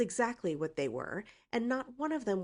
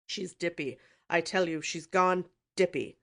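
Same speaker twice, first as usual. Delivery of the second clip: angry snort; 7 dB louder than usual. A narrator's reading. Uncle angrily dismissing his niece, snorting loudly. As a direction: slam the word dippy down in anger. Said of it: "he snorted angrily".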